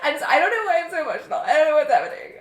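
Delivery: a mock emotional outburst breaking into laughs; teary laughing plea. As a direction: play yourself cracking up emotionally mid-request. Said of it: "two sentences in and be like".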